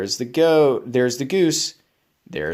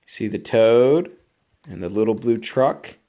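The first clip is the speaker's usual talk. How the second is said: plain reading voice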